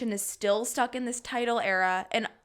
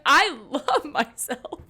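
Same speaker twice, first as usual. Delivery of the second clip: slow and drawn out, laughing through it; laughing self-love; 3 semitones higher, 6 dB louder, much slower than usual. Retold or imitated. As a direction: say it slowly while cracking up. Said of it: "was like".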